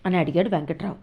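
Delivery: ordinary delivery, nothing marked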